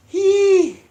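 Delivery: high, loud squeal of pain. Real, not acted. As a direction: squeal through the burn. Burning pain from hot pepper.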